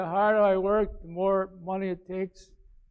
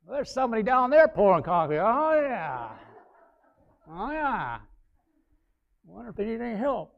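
eager inner thought; 3 semitones higher, 4 dB louder than usual. Retold or imitated. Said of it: "I'm thinking this".

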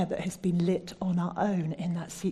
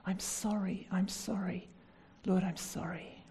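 much softer, hushed repentance; soft confession; 7 dB quieter than usual. Acted. Preacher modelling a confession to the Lord, soft and hushed. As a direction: murmur it as a private apology.

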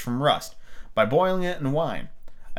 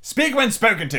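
high, louder sharp rebuke; sharply; 9 semitones higher, 4 dB louder than usual. A narrator's reading. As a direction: snap it like a haughty queen. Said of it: "the Queen said sharply".

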